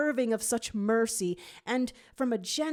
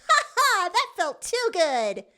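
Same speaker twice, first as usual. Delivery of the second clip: much higher, louder laughing; gleeful laugh; 12 semitones higher, 8 dB louder than usual. A narrator's reading. Jade slashes enemies, gleeful high laugh. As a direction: cackle with delight.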